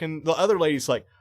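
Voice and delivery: ordinary talk, nothing marked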